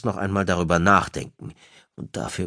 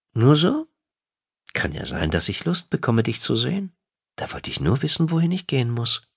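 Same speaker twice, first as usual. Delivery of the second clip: a hint of a higher female voice, playful; lightly voiced wife, coy; 7 semitones higher than usual. Wife teases husband about wanting to see him, lightly voiced and higher. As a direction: lightly play the teasing wife, pitched up.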